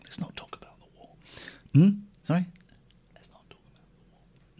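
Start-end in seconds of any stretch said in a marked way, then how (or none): 0.0-1.1 barely audible whisper; hushed, voiceless aside. Acted. Hushes a forbidden topic. 3.2-4.6 furtive whispered warning; voiceless hushed aside. Acted. Hushes a forbidden topic.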